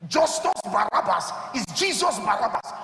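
ordinary delivery

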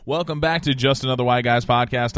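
booming announcer intro; grand entrance announcement. Acted. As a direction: welcome them like a ringmaster. Podcast opening, announcer intro.

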